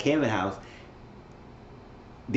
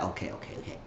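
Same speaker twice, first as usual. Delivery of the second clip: much softer, hushed okays; retold giving in; 4 semitones lower, 14 dB quieter, faster than usual. Danny giving in to Zach's complaint, hushed retold concession. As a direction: back down under your breath. Danny gives in when confronted.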